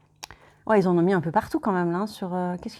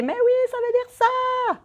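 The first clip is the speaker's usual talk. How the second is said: much higher, slow, mock-exasperated shout of viewers; excited viewers; 13 semitones higher, slower than usual. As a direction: play an impatient fan yelling at the screen. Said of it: "derrière l'écran en train de s'exciter".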